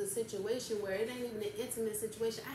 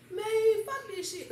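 imitating Leah; 12 semitones higher, 8 dB louder, much slower than usual. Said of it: "Leah probably be like".

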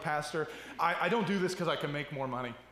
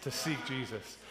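soft, low, tender encouragement; gentle reassurance; 6 semitones lower, 7 dB quieter than usual. Retold or imitated. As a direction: comfort him quietly and kindly. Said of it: "I go".

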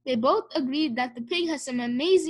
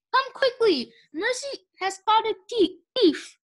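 high, loud, breathless call; shout, tripping over the word thief; excited shouting; 9 semitones higher, 3 dB louder than usual. A narrator's reading. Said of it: "shouted Baby".

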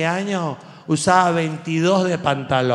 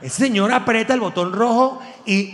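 ordinary talk, nothing marked

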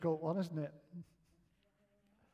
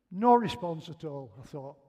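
usual speaking voice

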